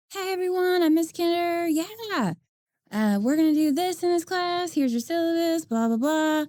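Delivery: high-pitched, sing-song teacher introduction; teacher's first-day spiel. Acted. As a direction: pitch it high, greeting a new class. Voicing herself on first school day.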